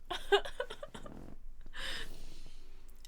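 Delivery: long high breathy laugh. Real, not acted. Laughs after woohoo fun times.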